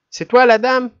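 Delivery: high, loud teasing jab; mocking. A narrator's reading. Arthur mocking his sister's airs, loud and high. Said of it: "et rit très fort".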